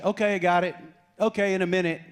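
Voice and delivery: high, loud brush-off; dismissive teen. Retold or imitated. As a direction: fob off dad who asks about the trash. Teenage son brushing off dad.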